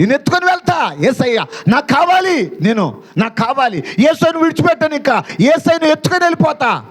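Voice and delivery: high, fast, insistent declamation; impassioned preaching. Acted. Preaches at full pitch. (ఉద్వేగంతో పట్టుబడుతూ ఎత్తైన స్వరంలో ప్రసంగం)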